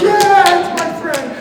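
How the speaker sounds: high-pitched mock cheer from a kid. Pupils cheering a friend brought on stage, mock excited cheer.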